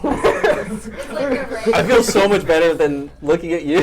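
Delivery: slow words broken by laughter; laughing. Real, not acted. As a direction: laugh your way through it. Laughing while sweating from sauce.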